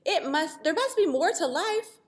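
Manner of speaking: triangle whining. Acted. Triangle's discontented plea.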